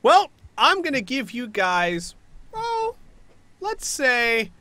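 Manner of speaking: slow, drawn-out, high teasing; toying villain. Acted. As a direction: stretch out the decision mockingly. Villain toys with a deadline.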